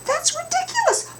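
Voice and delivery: very high squawk; squawking hen. Acted. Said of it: "the hens squawked".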